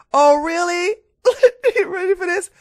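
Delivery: high, loud sarcastic "oh really" with a laugh; sarcastic disbelief. Real, not acted. Reacting to a commenter before reading his post, high and sarcastic. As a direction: taunt the commenter, amused.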